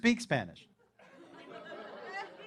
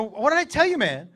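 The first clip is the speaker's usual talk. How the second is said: raised, loud telling-off; exasperated scolding; 4 semitones higher, 6 dB louder than usual. Retold or imitated. Dad to disobedient son after waiter leaves, exasperated. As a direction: scold him with raised pitch and volume.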